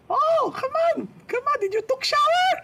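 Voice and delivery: put-on a pushy Filipino nurse nagging in her accent. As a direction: Filipino accent, nurse scolding him to shower. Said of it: "there was a Filipino nurse".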